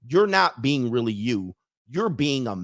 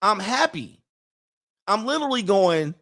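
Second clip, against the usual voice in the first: ordinary talk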